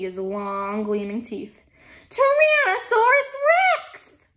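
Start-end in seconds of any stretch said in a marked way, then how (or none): 2.1-4.2 very high, loud, drawn-out cry; alarmed shout. A narrator's reading. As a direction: shout it slowly at the top of the voice. Mistaken shout before whispered retake.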